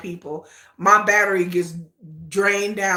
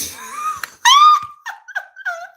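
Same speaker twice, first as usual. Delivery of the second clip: very high, loud laughing squeal; giddy squeal; 6 dB louder than usual. Real, not acted. Fan ready to marry her favorite, giddy high squeal. Giddy after 'ready to get married'.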